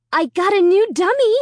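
high, loud and eager; excited. A narrator's reading. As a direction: burst with excitement. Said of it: "I told her excitedly".

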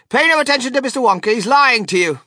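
high, loud, rapid outburst; indignant. A narrator's reading. Mother telling Veruca that Wonka lies, indignant. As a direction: rush it out indignantly at high pitch.

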